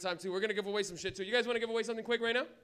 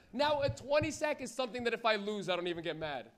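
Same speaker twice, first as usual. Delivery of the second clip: usual speaking voice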